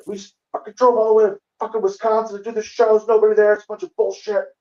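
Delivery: comic ranting to his friend after an empty show; mock furious rant. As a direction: vent like a sulking, fed-up comic.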